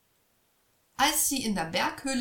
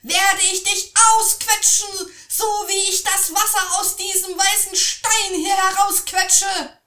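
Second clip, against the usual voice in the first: put-on boy shouts down the troll, much higher and louder; 11 semitones higher, 11 dB louder than usual. A narrator's reading. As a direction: scream the boy's bluff at the troll. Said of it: "schrie er den Troll an".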